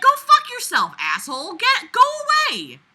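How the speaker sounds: high, loud pretend telling-off; imagined fury; mock yell. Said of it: "I'd be like".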